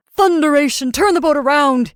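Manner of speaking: mad, high, loud roar. A narrator's reading. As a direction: roar the order in a rage. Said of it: "he roared".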